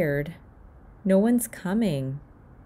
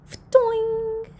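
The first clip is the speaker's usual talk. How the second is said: high springy twang of a rope; twang sound effect; 3 dB louder than usual. A narrator's reading. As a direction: make the clothesline boing up high.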